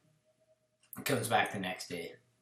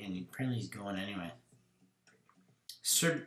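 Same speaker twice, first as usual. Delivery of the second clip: ordinary talk, nothing marked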